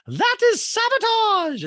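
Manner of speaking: imitating an outraged housewife at dinner; high put-on Dorit. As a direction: squeal it as a hammed-up accusation. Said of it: "Dorit's like".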